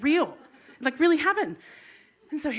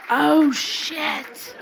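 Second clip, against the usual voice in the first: laughing dismay; 4 semitones higher, 6 dB louder, much slower than usual. Retold or imitated. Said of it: "I was like".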